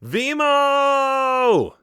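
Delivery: a long, very high yell of his name. A narrator's reading. Rescuer chasing a falling sheep, yelling his name. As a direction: call the falling sheep's name after him. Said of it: "yelled his name as I sped down".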